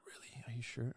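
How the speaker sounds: whispered, hesitant question; hushed doubt. Retold or imitated. Co-star hesitates before the prank take, hushed and doubtful.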